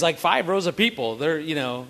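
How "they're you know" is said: usual speaking voice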